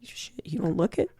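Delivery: breathy whispered aside; whispered curse. Retold or imitated. Whispering a jab about how old they look.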